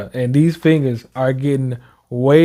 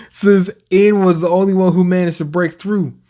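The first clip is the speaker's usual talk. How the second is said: normal talking voice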